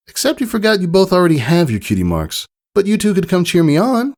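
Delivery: high, bright-eyed filly voice; a proud young filly sharing big news; eager young pony girl; Scootaloo. Acted. Scootaloo answers friends.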